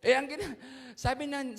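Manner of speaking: normal talking voice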